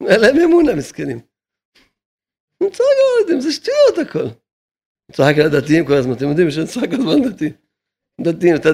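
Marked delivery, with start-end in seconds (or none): 2.6-4.2 high mocking voice. Rabbi scoffing at those arguing over two hundred shekels, high and mocking. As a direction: jump way up into a high, incredulous mocking pitch.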